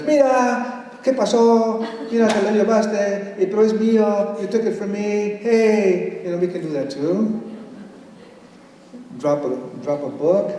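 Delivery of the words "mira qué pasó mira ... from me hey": higher, casual friendly banter; mock outrage between friends; talking to friends. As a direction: tease a buddy over something he took. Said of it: "we can speak like our friends".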